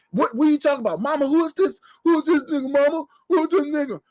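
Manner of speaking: put-on high, whiny mama's boy calling for his mother; spoiled grown son. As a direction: squeak it up like a pampered son wanting his sandwich. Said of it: "a Vin Reams come in the house".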